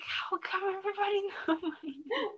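high-pitched retold fluster with a laugh; squeaky, giggly mock embarrassment; embarrassed. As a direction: recreate mortified disbelief, voice way up. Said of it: "really, like, embarrassed".

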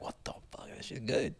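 hushed amazed whisper. Retold or imitated. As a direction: whisper it in awe. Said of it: "I'm like".

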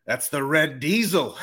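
slow, drawn-out Irish lilt from the barman; a light Irish pub accent, slowly; Irish bloke. Retold or imitated. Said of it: "Like, he goes".

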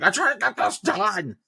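high, strained mocking voice; put-on high-pitched complaint; whining protest. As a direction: imitate a worker hollering back in protest. Worker's irritated shout back, acted.

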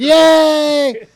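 high, loud fake cheer; mock cheer. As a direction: cheer like a crowd. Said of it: "everyone goes".

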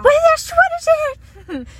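high, loud excited repeat; excited squeal. Real, not acted. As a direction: burst out in delight. Excited shout at passing dogs.

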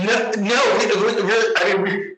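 ordinary delivery

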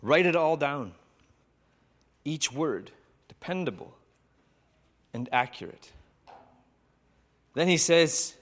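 measured, reverent storytelling with long pauses; sermon story narration. A narrator's reading. Preacher retelling John's vision to listeners, reverent.

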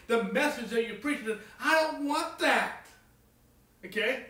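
fervent preacher challenge; preaching at full force. Acted. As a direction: press the congregation hard. Preacher pressing the congregation.

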